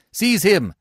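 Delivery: high, loud command; shouted order. A narrator's reading. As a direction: order the officers in. Tribune orders the officers.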